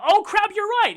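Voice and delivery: high, loud sudden realization; surprised realization. Retold or imitated. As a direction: snap into it, caught off guard. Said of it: "I'm like".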